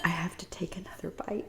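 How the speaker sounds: soft unvoiced whisper. Real, not acted. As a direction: whisper it like a secret aside. Whispered aside to viewers while eating.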